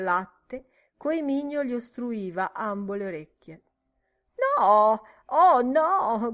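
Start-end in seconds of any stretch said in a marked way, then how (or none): 4.6-6.3 long, drawn-out loud refusal; crying out. A narrator's reading. Miss Kennedy refusing to hear the story, crying out. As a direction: wail it out, slow and raised. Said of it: "gridò".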